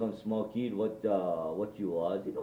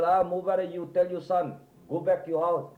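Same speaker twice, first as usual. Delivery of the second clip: raised-pitch, louder retold order; retold telling-off; 8 semitones higher, 3 dB louder than usual. Old man telling Manang to send the son home, mock scolding. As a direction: imitate someone ordering a person off.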